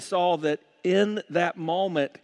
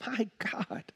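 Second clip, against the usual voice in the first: whispered, broken and much softer; anguished whisper; 6 semitones higher, 8 dB quieter than usual. Retold or imitated. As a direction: let the cry collapse into a whisper. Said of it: "Jesus cries out".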